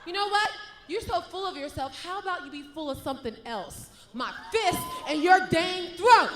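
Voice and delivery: sassy heel promo, ending in a shout; mock her, then threaten; sassy trash talk.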